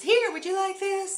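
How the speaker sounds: high, loud eager sales pitch; chirpy mall kiosk hawker calling out; pushy mall saleswoman. Retold or imitated. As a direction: mimic a saleswoman grabbing a passerby. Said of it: "somebody just grabs you and says".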